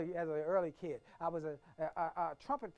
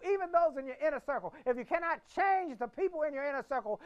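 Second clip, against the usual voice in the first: much higher and louder, preaching to the crowd; full-force preaching; 10 semitones higher, 8 dB louder than usual. Acted. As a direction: preach it out big and high.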